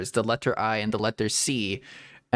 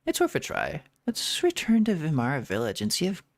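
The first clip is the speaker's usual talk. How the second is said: lightly voiced game characters, read out; casual dialogue read-out. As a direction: read the quest lines as a light, lively read-along. Reads game dialogue aloud.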